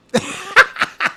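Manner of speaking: high-pitched burst of laughter; laughing. Real, not acted. Host after voicing senator's imagined thought, real laughing. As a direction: crack up at your own joke.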